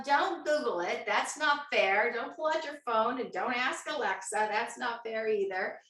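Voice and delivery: normal talking voice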